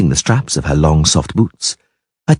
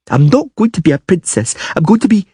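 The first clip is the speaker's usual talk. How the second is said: put-on stubborn child; 13 semitones higher than usual. A narrator's reading. Ciri insisting.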